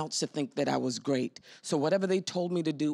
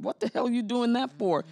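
high, louder scoffing disbelief; incredulous mock challenge; 8 semitones higher, 3 dB louder than usual. Doubters around an artist, scoffing in mock disbelief. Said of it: "people around you going".

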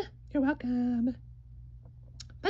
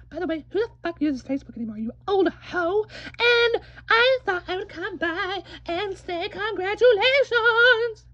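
ordinary delivery

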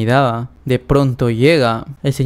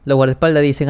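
plain reading voice

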